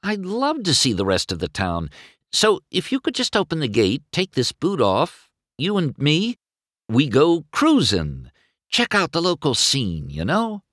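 high, breezy sweet-talk; slyly over-friendly persuasion; crafty. A narrator's reading. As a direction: coax them into doing you a favor. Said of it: "McQueen said craftily".

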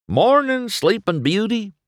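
high, loud singsong wake-up call; singsong greeting. A narrator's reading. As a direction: sing it out to someone just waking. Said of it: "the tow truck sang".

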